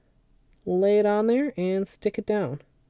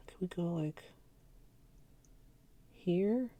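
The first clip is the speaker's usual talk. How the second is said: whispering; 13 dB quieter than usual. Real, not acted. Whispering while working.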